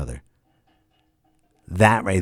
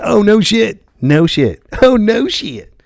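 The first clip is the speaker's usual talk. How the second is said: loud, higher, laughing "no shit"; laughing disbelief; 13 semitones higher, 8 dB louder, slower than usual. Pretend. The imagined cop bursts out laughing in loud disbelief. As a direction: crack up in disbelief.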